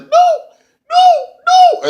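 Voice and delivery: giggling refusal. Retold or imitated. Allie refusing to hand over the brace, very high giggly squeal. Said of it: "And Allie's like".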